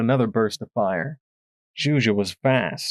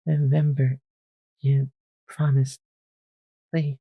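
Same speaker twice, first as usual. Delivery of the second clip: begged; 3 semitones higher than usual. A narrator's reading. Dying Twist begging Shuja, weak pleading. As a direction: beg her with the last breath. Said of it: "she begged".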